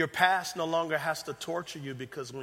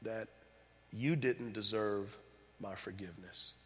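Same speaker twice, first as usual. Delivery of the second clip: God's tender voice; 5 semitones lower, 6 dB quieter than usual. Retold or imitated. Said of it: "he was saying".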